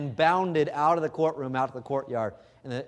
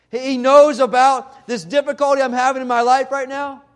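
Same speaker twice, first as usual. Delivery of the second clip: rising, louder, higher-pitched proclamation; fervent preaching; 9 semitones higher, 9 dB louder than usual. Acted. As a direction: preach it out with mounting fire. Preacher voicing believer's discovery.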